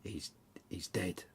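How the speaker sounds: slow, breathy whispered news of death; hushed whisper. A narrator's reading. Husband to wife, whispering the news of a death. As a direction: drop to a whisper on the fateful word.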